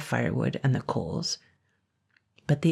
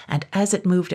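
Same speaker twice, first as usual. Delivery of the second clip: usual speaking voice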